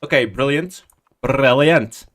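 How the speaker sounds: normal talking voice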